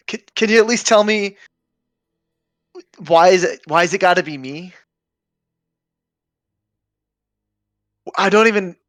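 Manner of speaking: desperate high, loud cry to the heavens; a lost man yelling at the god above; shouting to the skies. Acted. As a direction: plead upward at the top of your voice. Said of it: "I'll shout out to the skies".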